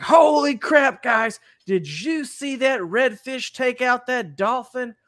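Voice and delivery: high, slow, drawn-out amazement; excited vlogger. Retold or imitated. Friend filming a fishing video, gushing high and slow. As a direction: hype it up for the camera. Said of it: "It's like".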